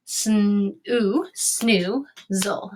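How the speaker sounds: "Sn-, ooh, snoo, zol" piece by piece; sounded out. Shown as a demonstration. Teacher modeling a two-syllable word, "snoo, zol" in pieces. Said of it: "let's go ahead and do this".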